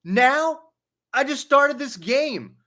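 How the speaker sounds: high whiny outburst at the interruption; retold angry teen. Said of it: "I hear him just go".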